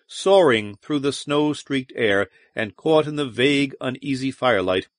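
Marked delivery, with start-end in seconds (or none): none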